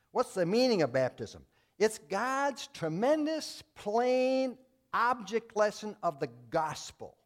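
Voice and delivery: preacher building to a climax; full-force preaching. Acted. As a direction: drive home the point from the pulpit.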